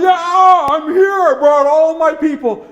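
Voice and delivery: high, loud eager dog. Acted. Dog leader tells the chief he brought everyone, high and eager. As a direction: bark out a proud hello.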